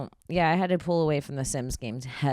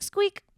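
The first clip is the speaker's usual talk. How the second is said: very high squeaky sound effect; high squeak; 4 dB louder than usual. Shown as a demonstration. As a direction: give the editor a rubber squeak. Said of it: "if you can add a squeak".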